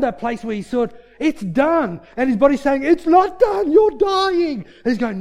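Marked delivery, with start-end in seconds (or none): 1.2-2.0 loud, high, full conviction; emphatic declaration. Acted. Man in place of rest declares healing, loud and sure. Uncle declaring faith against his body. 2.8-4.6 very high, loud contradiction; frantic shouted objection. Acted. His body argues back against his faith, frantic shouting.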